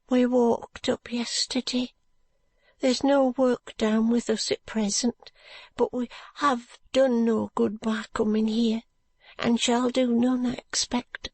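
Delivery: a worn-out working woman with no hope left; weary brickmaker's wife. Acted. Narrator voices poor brickmaker's wife.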